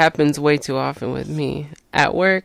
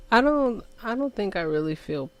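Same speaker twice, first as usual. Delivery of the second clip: normal talking voice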